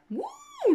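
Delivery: very high, loud "ooh"; sustained high woo of excitement; high whoop. Real, not acted. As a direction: let out a piercing delighted whoop. Reacts on seeing the serpent card.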